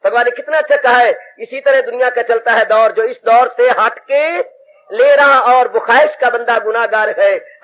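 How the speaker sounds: ordinary delivery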